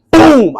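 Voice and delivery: loud, high "boom"; explosive left-hook impact noise; imitated big punch. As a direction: let the hit boom out.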